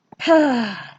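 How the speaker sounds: a long loud satisfied exhale; big relieved sigh after finishing; contented sigh. Real, not acted. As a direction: breathe out, pleased it's done. Satisfied end of his story.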